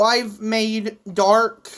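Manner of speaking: ordinary delivery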